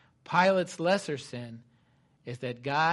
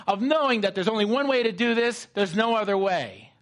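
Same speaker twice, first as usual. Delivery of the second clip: louder, raised, driving sermon voice; fervent preaching; 6 semitones higher, 7 dB louder than usual. Acted. Preacher to congregation on God's pain, fervent. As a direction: preach it with full force.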